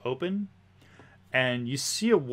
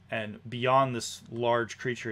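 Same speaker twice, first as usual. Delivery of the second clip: ordinary delivery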